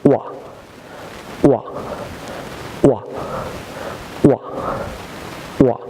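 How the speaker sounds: ওয়া said as a short "wa" with no h; teacher drilling the sound ওয়া; model sound. Shown as a demonstration. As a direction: clip ওয়া to a quick "wa". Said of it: "আমার সাথে বলুন".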